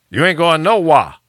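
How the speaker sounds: put-on high, loud Florida country accent; Andre. Host quotes his buddy baffling him, loud high Florida country accent. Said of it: "speak like the country folk".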